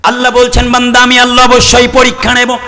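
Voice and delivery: high, emphatic proclamation; full-force preaching. Real, not acted. Preacher voicing God warning His servant of the test, high emphatic preaching.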